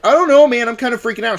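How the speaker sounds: mildly hammed-up unease; mock nervous co-host. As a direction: play a jittery friend, lightly. Co-host Justin voiced as nervous.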